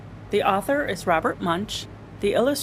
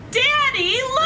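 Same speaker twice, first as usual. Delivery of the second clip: calling daddy; 14 semitones higher than usual. Acted. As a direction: shout for daddy across the aisle. Taya calls her father again.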